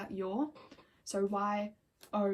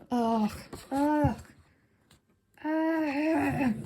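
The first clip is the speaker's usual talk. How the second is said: loud, rising swoon groans; 4 semitones higher, 6 dB louder, much slower than usual. Real, not acted. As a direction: groan with delight at the photos. Swooning over photos.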